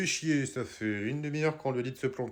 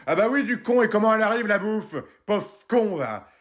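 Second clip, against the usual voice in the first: angry retort; 5 semitones higher, 4 dB louder than usual. Acted. Wife answering angrily.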